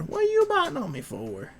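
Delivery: very high put-on dog voice. Owner voicing the dog's reply, very high put-on voice. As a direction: say it as the dog would.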